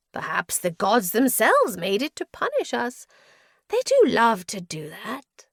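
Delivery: loud, high, swooping madman; mad prisoner, theatrical. A narrator's reading. Prisoner explains his part in the spirit, madly theatrical. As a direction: declaim it with manic relish.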